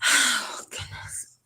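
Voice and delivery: tearful sighed whisper, voice giving out; holding back tears. Real, not acted. Speaker overcome while sharing her eating disorder story, holding back tears. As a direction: sigh it out, fighting the urge to cry. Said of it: "I'm trying not to cry".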